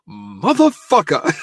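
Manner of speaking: high, drawn-out indignant swear; mock exasperated curse. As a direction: stretch the curse out, pitched high. Retold husband's reaction to walkout.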